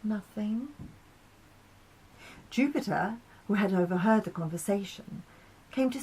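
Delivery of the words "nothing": shy. Acted. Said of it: "suddenly shy".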